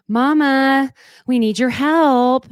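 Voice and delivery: put-on high, loud little-kid call from upstairs; a mild imitation of a toddler calling out; calling child. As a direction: call for mama like a small child in distress.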